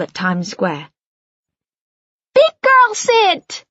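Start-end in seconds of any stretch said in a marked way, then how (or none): 2.3-3.6 put-on very high, slow little girl shout; child yelling. A narrator's reading. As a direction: correct him like a bossy toddler. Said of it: "Bella yells, correcting Noah".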